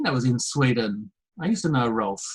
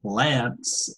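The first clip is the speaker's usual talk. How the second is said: American "Lance" with a short flat a; American accent on "Lance", front vowel; American pronunciation; 2 semitones lower, 5 dB quieter than usual. Shown as a demonstration. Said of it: "if I did an American accent".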